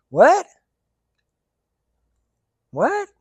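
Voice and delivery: high, loud baffled what; high puzzled double what. Real, not acted. Baffled by the riddle.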